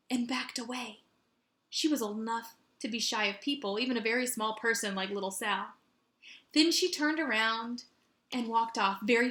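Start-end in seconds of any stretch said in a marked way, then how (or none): none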